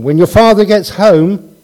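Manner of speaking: a hint of a mother warning her kids; tired mother threat.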